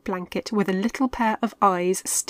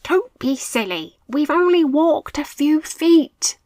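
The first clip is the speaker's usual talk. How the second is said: higher, louder mocking leader; dismissive; 8 semitones higher, 7 dB louder than usual. A narrator's reading. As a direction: brush off the fuss. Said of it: "Mitch replied".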